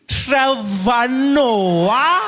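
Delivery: high, loud, very drawn-out question; incredulous recognition. Acted. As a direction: stretch the name in disbelief. Receptionist recognises the comedian.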